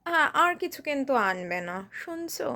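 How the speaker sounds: low, soft, lingering request; tender, hesitant wheedling; coy coaxing partner. Acted. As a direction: sweet-talk your partner gently for a present. Coaxing partner for birthday gifts.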